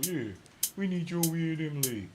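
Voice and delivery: high, loud imitation of a hype recruiter; mock recruiter. Imitating someone calling him to the league, high and loud.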